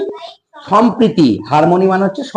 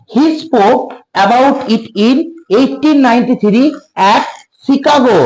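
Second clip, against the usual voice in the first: slow dictation; 4 semitones higher, much slower than usual. Shown as a demonstration. Teacher dictating English slowly.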